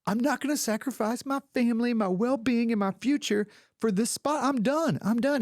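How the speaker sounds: high, worked-up fake tirade; mock outraged quitting. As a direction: ham up a star storming off set. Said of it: "he went in for it".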